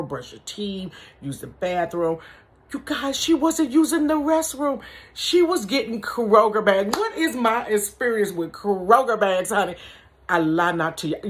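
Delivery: ordinary delivery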